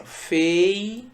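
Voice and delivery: "fei-u-ra" in three slow, held beats; "fei-u-ra" dictated slowly with gaps; split into syllables. Shown as a demonstration. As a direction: stretch and separate each syllable of "fei-u-ra". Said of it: "eu separo assim".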